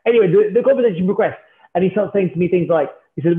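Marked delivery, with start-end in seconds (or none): none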